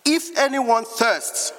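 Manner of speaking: high, drawn-out proclamation; crying out. Acted. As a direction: call it out high and slow. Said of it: "and cried out".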